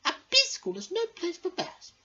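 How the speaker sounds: high, bright queen bee voice. Acted. The queen bee greets her pupils at school. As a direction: greet the class like a grand queen. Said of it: "said the queen".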